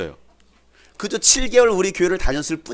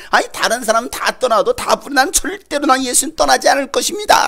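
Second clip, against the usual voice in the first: boastful Peter; 8 semitones higher, 4 dB louder than usual. Retold or imitated.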